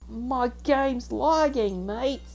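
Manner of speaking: drawn-out, high-pitched Aussie complaint; hammed-up Australian, stretched and high; Australian accent. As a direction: whine it slowly in a put-on Australian voice. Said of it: "I'm done with the Australian accent".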